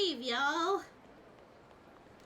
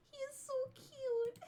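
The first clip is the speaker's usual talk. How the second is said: breathy hushed coo over the pet; whispered cooing; 6 semitones higher, 5 dB quieter than usual. Real, not acted. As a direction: whisper adoringly.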